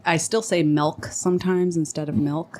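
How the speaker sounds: first "milk" said as "melk", second "milk" with a clear short i; "milk" first as "melk", then the standard "milk"; pron demo. Shown as a demonstration. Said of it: "Like I say".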